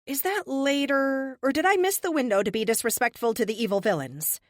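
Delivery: high, sarcastic back-talk; flippant captive. Acted. Captive answering a villain's scolding, flippant.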